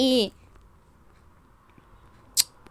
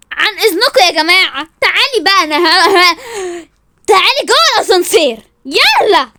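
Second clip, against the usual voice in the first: loud, higher commands; bossy shouting; 3 semitones higher, 7 dB louder than usual. Acted. Woman orders rival into the elevator, shouting. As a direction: order her inside forcefully.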